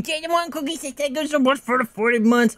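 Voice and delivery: high squeaky cartoon read; silly high voice. Reads sub message in put-on voice.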